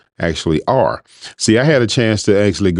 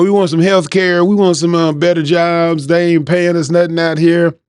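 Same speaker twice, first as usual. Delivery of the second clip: voicing the Trump fans in their accent, higher; 8 semitones higher than usual. Narrator imitating Trump fans at rallies, accent pitched up. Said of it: "I quit using that accent".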